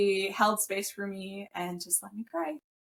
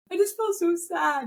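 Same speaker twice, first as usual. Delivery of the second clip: mock sad whine; 9 semitones higher, 4 dB louder than usual. Telling husband she felt sad.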